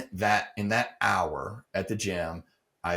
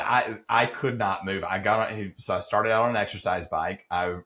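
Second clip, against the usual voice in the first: ordinary talk, nothing marked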